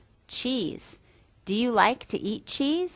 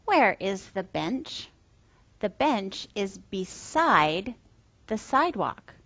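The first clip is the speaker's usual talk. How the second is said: gentle, singsong talk for toddlers; reading to a toddler; 4 semitones higher, 3 dB quieter than usual. Acted. Teacher voice for toddler lessons.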